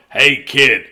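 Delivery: loud, stern shout to pull over; police officer yelling. Acted. Police officer to speeding Loretta, loud stern shout. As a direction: bark it like a cop at a speeding kid.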